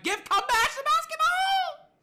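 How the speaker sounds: high-pitched, full-force sports fan scream; very loud, drawn-out frustrated scream; screaming at the television. Retold or imitated. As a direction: yell at the players on TV, stretching the last word. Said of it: "I was screaming at my television".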